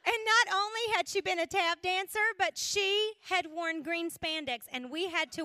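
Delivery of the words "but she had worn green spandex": ordinary talk, nothing marked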